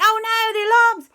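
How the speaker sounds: hammed-up worry at the alarms; alarmed. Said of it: "And I went".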